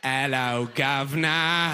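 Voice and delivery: drawn-out cockney caricature; mock British accent. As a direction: parrot a Brit, savouring each sound. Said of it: "and you'll be like".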